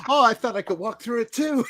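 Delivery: high-pitched imitation through laughter; laughing film quote. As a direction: quote the character while cracking up. Acts out film line, laughing.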